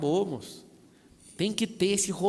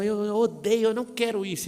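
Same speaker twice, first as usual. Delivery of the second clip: higher, louder mock-upset wife; wife's protest; 5 semitones higher, 6 dB louder than usual. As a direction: imitate an exasperated wife objecting. Acts out wife objecting. (esposa indignada, imitada em tom agudo)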